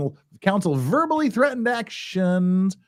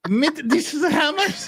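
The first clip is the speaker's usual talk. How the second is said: put-on German accent; 11 semitones higher, much slower than usual. German-tinged barbarian line.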